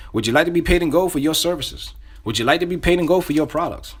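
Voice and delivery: upbeat scripted question with punch; enthusiastic. Shown as a demonstration. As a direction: pitch the offer eagerly. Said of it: "Say it with enthusiasm".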